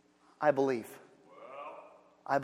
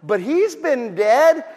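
exasperated Martha; 6 semitones higher, 8 dB louder than usual. Retold or imitated. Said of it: "she goes".

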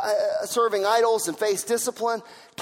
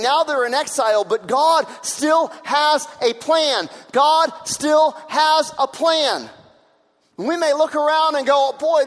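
higher, louder preaching that slows to shouted declarations; preaching at full force; 4 semitones higher, 4 dB louder than usual. Acted. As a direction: hammer home that God still has a plan. Preaching peak on God's plan.

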